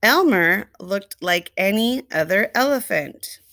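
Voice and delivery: ordinary delivery, nothing marked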